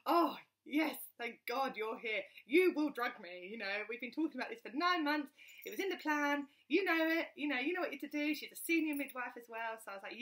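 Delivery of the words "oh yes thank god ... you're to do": relieved pleading. Retold or imitated. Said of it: "and I thought".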